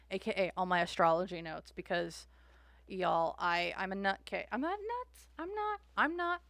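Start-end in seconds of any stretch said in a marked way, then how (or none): 4.5-6.4 high playful protest; squeaky insistent denial; mock defensive. Real, not acted. Denies being nuts, playful.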